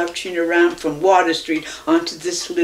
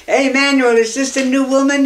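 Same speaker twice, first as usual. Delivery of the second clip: loud, higher hail across the street; calling out; 7 semitones higher, 6 dB louder than usual. Retold or imitated. Neighbor hailing Manuel from his doorway, loud call.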